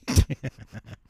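short, loud burst of laughter; amused outburst. Real, not acted. Laughs at own accent talk.

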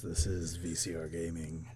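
hushed sultry show intro; soft and seductive, a hint of radio host. Cohost introducing the show, mock sultry voice. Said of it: "I can do sultry [name]".

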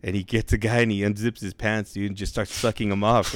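ordinary delivery

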